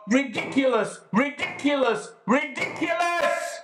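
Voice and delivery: each ridiculous climbing into a rage; losing temper. Acted. Prisoner ranting to visitor about police, building to a shout. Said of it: "Lost my temper a bit there".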